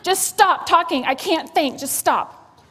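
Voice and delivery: loud, high frazzled snap; a loud, high frazzled snap at the kids; snapping at kids. Retold or imitated. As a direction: cut the kids off, overwhelmed.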